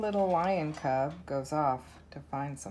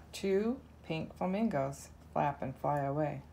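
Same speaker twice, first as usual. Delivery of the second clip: ordinary delivery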